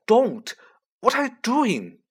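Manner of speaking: high, loud, urgent protest; urgent hiss. A narrator's reading. Boy stopping the elf hurting himself, urgent. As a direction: burst out in alarm to stop him. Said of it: "Harry hissed".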